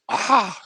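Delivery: high-pitched imitated "ah" of fright; mock startled gasp. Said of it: "everybody goes".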